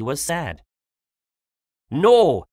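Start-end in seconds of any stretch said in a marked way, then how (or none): 1.9-2.4 loud, higher angry refusal; louder, raised-pitch storybook anger. A narrator's reading. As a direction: refuse with a burst of temper. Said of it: "he said angrily".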